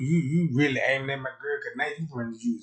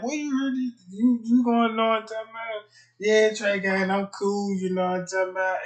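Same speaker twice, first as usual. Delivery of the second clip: high, loud mocking comeback; incredulous retort; 9 semitones higher, 6 dB louder than usual. Trey Gang loudly mocking the side chick's answer. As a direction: fire it back in disbelief. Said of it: "I'm like".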